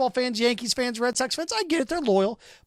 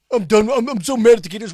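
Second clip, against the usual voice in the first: louder hammed-up angry fan; mock angry fan; 7 dB louder than usual. Host imitating fans mad at the Gators, loud mock rant. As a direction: ham up a fan storming off.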